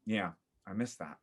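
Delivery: ordinary talk, nothing marked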